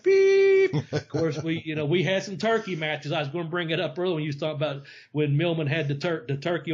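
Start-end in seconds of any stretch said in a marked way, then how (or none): 0.0-0.7 high, loud held beep; imitated censor beep. Mimicking the censor cutting off a swear, high beep. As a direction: cut in with a loud, piercing bleep. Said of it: "and they went".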